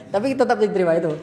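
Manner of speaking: ordinary talk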